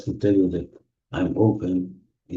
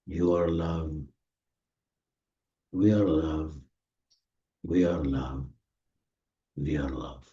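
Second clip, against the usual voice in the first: slow, gentle meditation voice; guided meditation. Acted. Meditation leader guiding the group through healing, soothing. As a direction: ease them into the feeling, unhurried.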